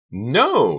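high, loud cry of refusal. A narrator's reading. Karina refuses Delia's demand, crying out. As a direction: cry it out in panic. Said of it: "Karina cried, trembling".

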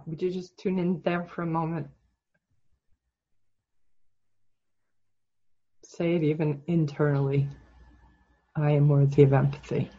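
calm, measured guide with long silences; guided meditation. Real, not acted. Coach leading client's inner affirmation, calm meditation voice. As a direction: leave long pauses for it to sink in. Leads guided self-empathy exercise.

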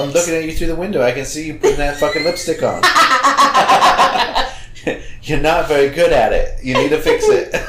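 high, giggling mock threat; laughing taunt. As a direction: tease through laughter. Mock taunting through window.

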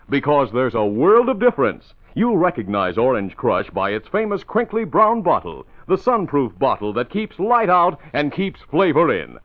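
bright, eager radio ad read; chipper 1950s ad. Acted. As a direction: sell it with cheerful pep. Announcer reads an Orange Crush ad.

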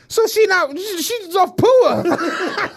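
high, laughing and stretched out; can't get it out for laughing; disbelieving laugh-talk; laughing disbelief. Retold or imitated. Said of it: "I'm like".

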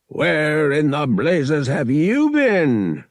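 loud, drawn-out angry demand. A narrator's reading. Captain confronting the late boy, angry demand. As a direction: bellow it, stretching out the words.

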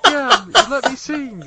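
loud, high cabbie voice; imitated cab driver. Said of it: "He's like".

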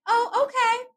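high, tiny swallowed "oh, okay"; mock meek acceptance. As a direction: pretend to take it calmly while hurt. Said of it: "I was just like".

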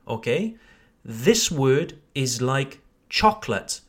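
ordinary talk, nothing marked

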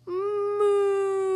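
long, high, sustained moo; loud moo. A narrator's reading. Newly hatched creature greets Marjorie, loud moo. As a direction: bellow a drawn-out baby moo. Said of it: "it said loudly".

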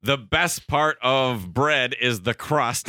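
slow emphatic. Real, not acted. Announces hot take slowly.

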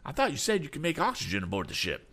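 fast, high-pitched protest; anxious cry. A narrator's reading. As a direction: protest in a rush of worry. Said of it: "cried Mister Damon".

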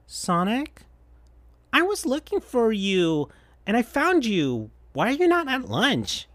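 gently worried little friend; Tails' voice. Acted. Tails finding Sonic alone at lunch, high and soft.

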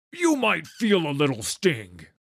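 looming monster doctor, menacing; Mundo's voice. Acted. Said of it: "the creature said".

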